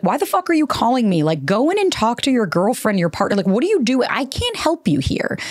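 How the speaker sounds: high, exasperated mock yell at the caller; retold angry yell. Mom yelling at the boyfriend who called her, exasperated mock yell. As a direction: play Mom snapping down the phone, fed up.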